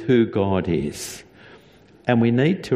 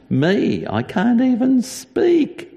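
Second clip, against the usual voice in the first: a lightly voiced high squeak of a protest; rising, incredulous Moses; 13 semitones higher, 3 dB louder than usual. Moses telling God he cannot speak, a high mock whine. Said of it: "Moses said to God".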